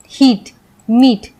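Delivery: हीट, मीट said slowly with a long clear ee; long ee demo. Shown as a demonstration. Teacher drilling students on ea words, slow long ee. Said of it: "ऐसे पढ़ेंगे".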